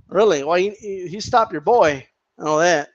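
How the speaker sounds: usual speaking voice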